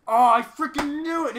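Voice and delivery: high, loud exasperated teacher; frustrated yell. Retold or imitated. Teacher finding the hiding class yells in exasperation. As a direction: belt it out like a fed-up teacher.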